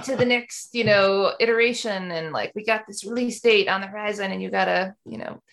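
tech bro voice; loud, pushy startup bro. Retold or imitated. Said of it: "my, my bro voice".

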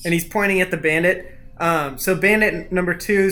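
normal talking voice

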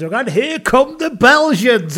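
high, slow, loud announcer cry. Pretend. Host announcing the teams to the crowd, big slow announcer call. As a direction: boom it out like a game show host.

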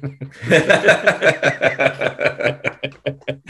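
long, loud cackling laugh; real burst of laughter. Real, not acted. Podcaster laughing at kangaroo court joke, real belly laugh. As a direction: crack up helplessly at a pun.

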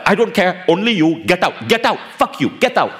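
high, heated demand to leave; angry guru. Retold or imitated. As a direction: throw the employee out like a raging guru. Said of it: "He's like".

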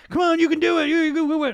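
high, loud, hyped-up cheerleading; mock the empty pep-talk, pumped up; mock cheerleader. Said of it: "I'm not talking about the bullshit".

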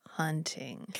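ominous hunting. Retold or imitated. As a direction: say it like a predator hinting at the kill. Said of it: "She says hunting in a way that".

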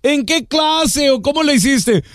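imitating a mom stunned by her son's grade; amazed mother. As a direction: gasp it like a surprised mother. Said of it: "llegó con su mamá".

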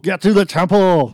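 put-on loud, high Austrian Arnold accent; Arnold impression. Correcting the quote for the co-host, loud Arnold accent. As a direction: shout the line like Schwarzenegger. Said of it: "Don't you mean".

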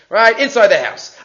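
usual speaking voice

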